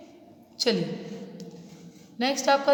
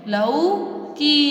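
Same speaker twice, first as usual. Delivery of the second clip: long drawn-out sound; 3 semitones higher, much slower than usual. Shown as a demonstration. Teacher stretching the word slowly for children. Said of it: "लंबी आवाज़".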